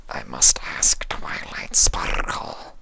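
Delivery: slow breathy alien whisper; hushed low creature voice; whispering changeling. Acted.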